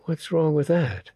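a light innocent lady; Samandi's voice. A narrator's reading.

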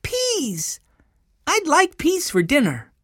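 put-on delight in it like a small boy; high, eager child; excited little boy. A narrator's reading. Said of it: "cried Benny".

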